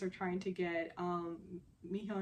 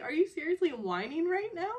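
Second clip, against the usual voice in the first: incredulous retort, pitched high and louder; acted-out, high-pitched disbelieving challenge; calling him out; 9 semitones higher, 6 dB louder than usual. Woman calling out a pouting man, incredulous. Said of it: "she calls him out".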